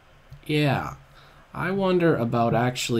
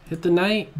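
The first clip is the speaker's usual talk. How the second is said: ordinary delivery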